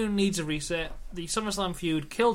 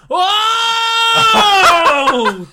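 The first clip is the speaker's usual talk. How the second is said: a huge, much higher and much louder shouted oh; shocked crowd shout; 17 dB louder than usual. Retold or imitated. Imitating the crowd reacting to shit with a loud shocked oh. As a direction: blast it like a stunned arena crowd. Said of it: "they go".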